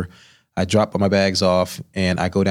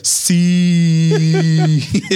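Sí held for a full breath, loud and high; long drawn-out sí; 8 semitones higher, 5 dB louder than usual. Retold or imitated. Drunk cook answering the chef, drawn out for one whole breath. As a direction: stretch Sí out till your breath runs out. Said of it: "saying this word for as long as I could hold my breath".

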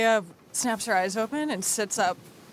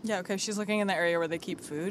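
normal talking voice

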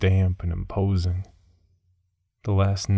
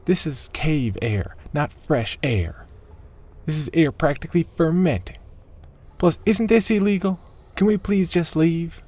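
exasperated; 9 semitones higher than usual. A narrator's reading. Margaret begs Nathan to leave the cave, rising exasperation. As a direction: build from complaint to shrill plea. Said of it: "She sounded more like the big bad wolf".

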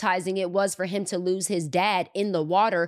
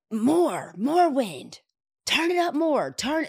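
angry command; 8 semitones higher, slower than usual. Retold or imitated. Frustrated creator ordering the operator, building anger. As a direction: push the storm harder, getting angrier. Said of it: "he's getting mad now".

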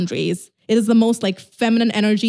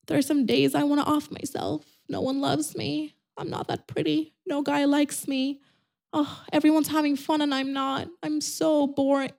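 higher, softer whiny self-pity; mock a lonely girl wallowing; parodying a self-pitying lonely woman, much softer; 5 semitones higher, 7 dB quieter than usual. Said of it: "not do the whole, like".

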